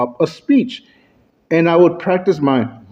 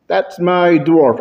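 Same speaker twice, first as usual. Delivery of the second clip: usual speaking voice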